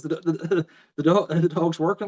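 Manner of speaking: high, tripping over the words; can't get it out for wonder; stammering amazement. Retold or imitated. Farmer to his wife, stammering in high amazement.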